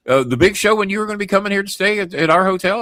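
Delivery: high, fast, starstruck manager; mock-awed hotel manager. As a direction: gush like a fan meeting a star.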